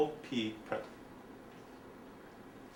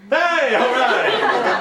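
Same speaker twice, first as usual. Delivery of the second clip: slow, loud, higher gloat; triumphant hacker; 6 semitones higher, 7 dB louder, much slower than usual. Acted. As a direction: crow over cracking the password.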